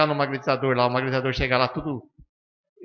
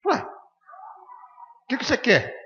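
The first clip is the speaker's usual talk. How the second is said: mock puzzled reaction; 13 semitones higher, much slower than usual. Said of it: "Ele olhou pra mim assim".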